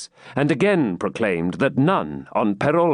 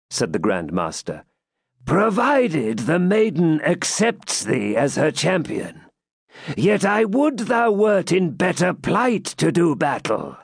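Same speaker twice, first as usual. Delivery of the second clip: grave Grand Master; 5 semitones higher than usual. Acted. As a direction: pronounce judgment solemnly. Grand Master grants the combat.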